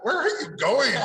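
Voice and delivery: high, loud mock dad voice; imitating dad. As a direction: squeak it out like an alarmed father. Dad reacts to exotic drawings.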